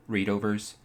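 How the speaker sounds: ordinary delivery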